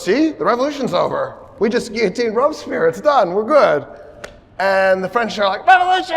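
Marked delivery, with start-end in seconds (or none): 0.0-3.9 mild hammed-up triumph; eager announcement. Leaders announce the revolution is over. 5.7-6.2 high, loud rallying yell; the crowd yelling the word back once more; mob shout. Acted. French mob answers a third time.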